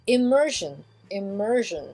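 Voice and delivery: "immersion" said im-MUR-zhun; English "immersion", stressed middle, zh ending; English zh sound. Shown as a demonstration. Teacher models the word for learners, "immersion" as im-MUR-zhun. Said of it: "ou alors, j, comme, euh, jeune".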